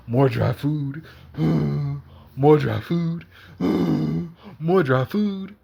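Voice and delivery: sighing before each weary complaint; drawn-out, put-upon cat grumble; Oreo the fed-up cat. Acted. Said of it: "I see Oreo's face just like".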